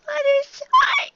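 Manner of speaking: very high, slow squeaked name; timid pony forcing out her name; squeaky whimper. A narrator's reading. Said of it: "The Pegasus mare whimpered".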